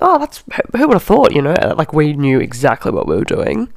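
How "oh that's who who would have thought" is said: high, feigned astonishment; sarcastic fake wonder; mock surprise. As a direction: act shocked as if caught off guard. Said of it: "we're all looking at each other like".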